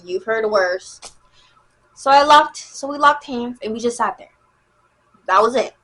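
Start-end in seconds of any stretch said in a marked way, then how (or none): none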